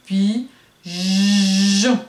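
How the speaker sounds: "pigeon" loud, pi, then a long buzzing "jjjon"; loud, split demonstration of "pigeon"; stretched J, checking. Shown as a demonstration. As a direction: hit "pigeon" harder, holding the J sound. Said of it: "Si on vérifie".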